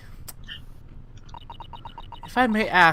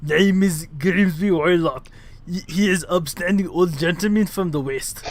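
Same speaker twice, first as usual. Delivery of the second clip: put-on Russian accent; 3 dB louder than usual. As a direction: redo it firmly in a Russian accent. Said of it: "let me redo this whole line again".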